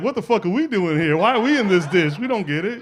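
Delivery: lightly voiced high, indignant peas; bewildered peas. Peas asking why they are in this dish, bewildered.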